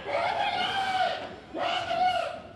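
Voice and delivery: very high, slow shout with laughter; shouting like mad. Retold or imitated. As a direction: stretch out the yell, half laughing. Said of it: "a gritar como loca". (grito agudo y alargado, entre risas)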